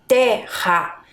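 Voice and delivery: terra in two slow parts, te-rra, with H-like RR; slow model of terra, strong double R. Shown as a demonstration. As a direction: break terra apart and push the RR. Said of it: "A pronúncia é forte".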